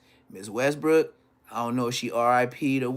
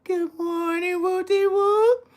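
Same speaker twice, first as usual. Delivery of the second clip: very high, drawn-out granny voice; old teacher; 3 dB louder, slower than usual. Retold or imitated. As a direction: imitate a frail old teacher greeting back. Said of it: "And she be like".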